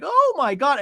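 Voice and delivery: a touch of hammed-up shock; mock shock. Podcaster voices the whole table's shocked reaction. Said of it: "the scene, like".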